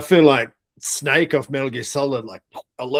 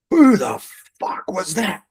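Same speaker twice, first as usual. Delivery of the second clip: higher, drawn-out angry sergeant voice; platoon sergeant's demand; 6 semitones higher than usual. Retold or imitated. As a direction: imitate a sergeant demanding the culprit slowly. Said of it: "And I hear this voice".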